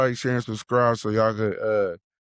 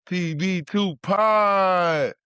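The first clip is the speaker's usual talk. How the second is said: show shout-out; 6 semitones higher, much slower than usual. Real, not acted. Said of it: "show tag call".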